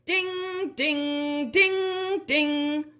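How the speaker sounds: high ringing ding calls; shouted bell sound. A narrator's reading. Juniper calling brothers, shouting ding like bell. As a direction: ring out like a church bell. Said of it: "stood on a rock and shouted".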